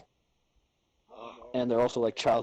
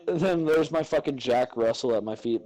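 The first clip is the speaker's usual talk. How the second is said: ordinary talk, nothing marked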